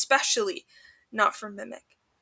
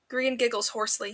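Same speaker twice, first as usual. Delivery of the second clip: ordinary delivery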